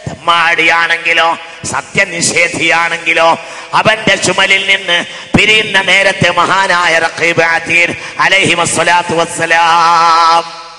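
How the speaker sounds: loud chanted sermon with drawn-out endings; sing-song preaching. Acted. Preacher reciting scripture to the congregation, chanted preaching. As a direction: preach it out, stretching the last syllables.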